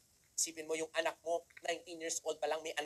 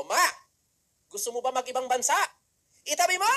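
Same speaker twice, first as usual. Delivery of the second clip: excited offer; 7 semitones higher, 9 dB louder than usual. Retold or imitated. Son offering mother a trip.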